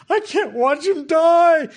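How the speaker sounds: high, loud, slow anguished mother; hammed-up despairing mother's wail; voicing Hagar. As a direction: cry it out as the desperate mother. Hagar voiced in retold story.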